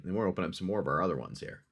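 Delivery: usual speaking voice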